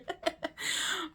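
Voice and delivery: a quick airy laugh at her own joke; amused breathy laugh. Real, not acted. Host laughing at her pasture joke, breathy chuckle. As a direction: let a soft laugh slip out.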